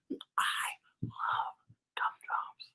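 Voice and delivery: soft whispered confession. Acted. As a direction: whisper it like a secret weakness. Secret weakness whispered.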